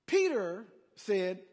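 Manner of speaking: normal talking voice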